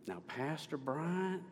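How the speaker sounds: soft, slow impression of an older acquaintance's voice; lightly voiced, unhurried address to the pastor; Miss Penner. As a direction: gently imitate a woman's recognizable voice. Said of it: "I can't do Miss Penner's voice".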